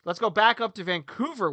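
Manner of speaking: ordinary talk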